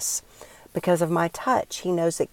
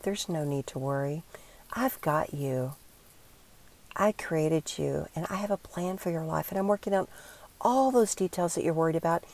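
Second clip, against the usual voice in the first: God's tender reassurance; 6 dB quieter than usual. Pretend. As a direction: speak as God comforting a child, very gently. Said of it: "Is he saying to you".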